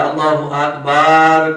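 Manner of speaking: اکبر ending in a long loud 'baaar'; wrong long Akbaar. Retold or imitated. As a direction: imitate the caller dragging out the end of اکبر. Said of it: "کر دیتے ہیں". (مکبر کی طرح اکبر کو اکبار کھینچ کر)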